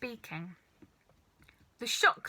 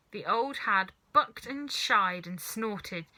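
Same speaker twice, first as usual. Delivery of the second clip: ordinary delivery, nothing marked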